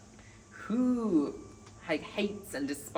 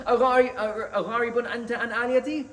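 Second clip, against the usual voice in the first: loud, higher, fast outburst of أغارب أنت عن آلهتي; indignant loud cry of أغارب أنت عن آلهتي, voice raised high; dramatic angry father; 6 semitones higher, 8 dB louder, much faster than usual. Retold or imitated. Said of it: "This very emotional and dramatic".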